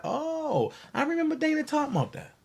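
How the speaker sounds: high, soft, quick viewer imitation; mock sudden recollection. Imagined viewer hearing old videos, mock sudden recollection. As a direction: mimic a viewer remembering, high and rushed. Voicing viewers' future realization.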